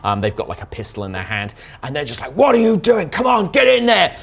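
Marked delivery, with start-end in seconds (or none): 2.4-4.1 furious foreman yelling higher and louder; angry shout. Acted. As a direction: bark orders at the cowering workers. NPC leader yelling at workers.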